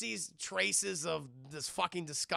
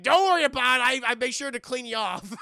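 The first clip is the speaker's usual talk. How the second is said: hammed-up reassurance; 6 semitones higher, 12 dB louder than usual. [name] reassuring Claire, comically.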